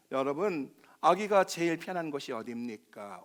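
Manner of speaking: ordinary delivery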